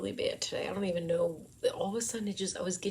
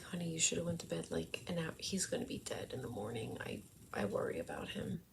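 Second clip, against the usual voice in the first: hushed to husband; 8 dB quieter than usual. Retold or imitated. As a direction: gently scold him under her breath. Telling husband he should sleep.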